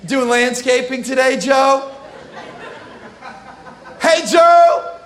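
playing a flirty temptress calling Joe; flirty wife. Potiphar's wife flirting with Joseph, mock seductive. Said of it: "She's like".

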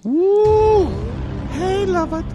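high whooping cheer; excited cheer. Real, not acted. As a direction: whoop it up for the crowd. Cheering at the show opening.

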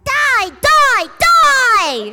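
high, loud menacing shout; Reaper in Diva's voice. Acted. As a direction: scream the villain line. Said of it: "do Reaper in Diva's voice".

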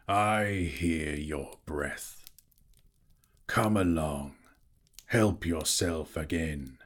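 deep, slow menacing dragon. A narrator's reading. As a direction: drawl it like a sly old dragon. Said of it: "Then Smaug spoke".

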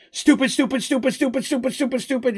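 high, frantic self-scolding chant; frantic Petal. Acted. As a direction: babble it high and scatterbrained. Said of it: "all she's saying is".

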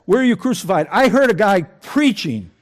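ordinary talk, nothing marked

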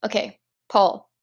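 normal talking voice

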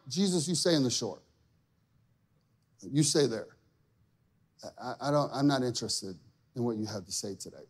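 low, soft, withdrawn dismissal; hushed reluctance with long pauses, last words hurried; quiet refusal. Retold or imitated. Believer keeping Jesus on the shore, quietly dismissive. Retold pushing Jesus away.

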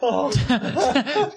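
high yelp breaking into laughter; sudden real cry of shock; startled yelp. Real, not acted. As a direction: yelp at something cold down the back. Cold can down the shirt.

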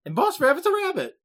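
high, amused disbelief. Real, not acted. Reacting to the rabbit boss, high amused disbelief.

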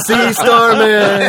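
high, slow, drawn-out shout at the sun; mock defiant yell. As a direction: shake your fist and howl it slowly. Shaking fist at sun, mock yell.

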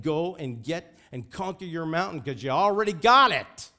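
fired-up preacher's shout, building hard; angry-sounding exhortation. Real, not acted. Preacher urging people to go conquer their mountain, angry-sounding shout. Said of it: "Sorry if I look angry".

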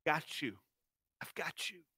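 barely above a breath, soothing him; soft comforting. Retold or imitated. Father holding his scared son tight, hushed and tender. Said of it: "and to say".

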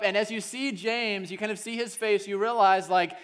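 loud, high, driving sermon voice; fired-up preacher telling the story; full-force preaching. Acted. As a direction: preach it out to the whole room.

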